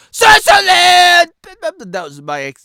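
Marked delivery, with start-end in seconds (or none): 0.1-1.3 louder, much higher mock outcry; hammed-up disbelief at the ban; mock outrage. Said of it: "that's a real example earlier".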